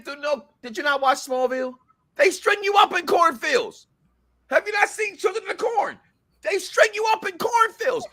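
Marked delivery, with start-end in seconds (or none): 2.1-8.1 alarmed rant. Real, not acted. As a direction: yell it like the corn scares you. Scared rant about cornfields.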